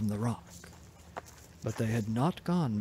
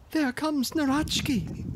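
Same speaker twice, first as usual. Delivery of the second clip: much higher, alarmed warning; alarmed cry; 3 dB louder than usual. A narrator's reading. Princess warns the brothers, high alarmed cry. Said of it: "cried out Tingaling".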